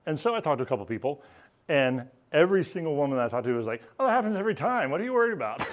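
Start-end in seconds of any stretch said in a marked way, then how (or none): 4.0-5.7 higher, mock breezy brush-off; imitating the women shrugging it off; breezy dismissal. Women brushing off his worry.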